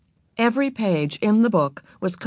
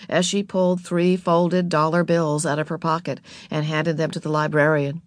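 ordinary delivery

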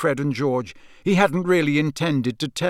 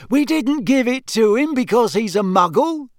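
much higher, louder affronted twin; a teenage son's indignant reply; 9 semitones higher, 5 dB louder than usual. A narrator's reading. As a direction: protest as if insulted. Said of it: "said Fred indignantly".

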